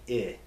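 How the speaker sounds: "I" said as ih, the vowel in hit; short I. Shown as a demonstration. Teacher gives the right sound, "I" as ih. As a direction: say "I" like bit's vowel. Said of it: "they're pronounced short I".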